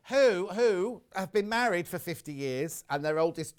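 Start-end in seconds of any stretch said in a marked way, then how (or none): none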